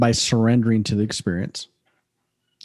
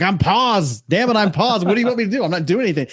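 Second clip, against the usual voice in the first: much higher, exasperated protest at the coach; mock frustration; 8 semitones higher than usual. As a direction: play yourself fed up, insisting you already stopped. Retold self, frustrated at coach.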